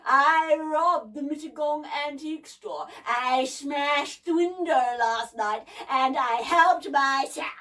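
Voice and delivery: loud, slow, booming boast; proud mechanical monster, drawn out; boasting crackly giant. A narrator's reading. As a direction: brag it out in a big crackly voice. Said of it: "boasted the voice".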